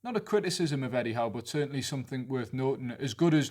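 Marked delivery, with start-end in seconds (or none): none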